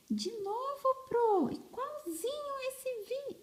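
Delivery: very high little pupil voice; squeaky, high-pitched pupil piping up; pupil questioning teacher. Acted. Pupil asking teacher about the repeated syllable, high pupil voice.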